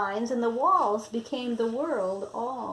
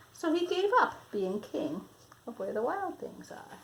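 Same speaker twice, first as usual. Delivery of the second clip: usual speaking voice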